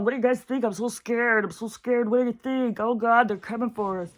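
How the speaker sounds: high, far-off hammed-up panic; mock scared voice. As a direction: parody frightened people begging for answers. Mocking scared posters.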